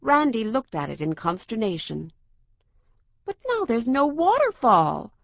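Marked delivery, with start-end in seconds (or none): 3.3-5.1 a touch of dismay, higher; mildly upset outcry over the lost waterfall; understated disappointed exclaim; dismayed cry. A narrator's reading. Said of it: "she cried".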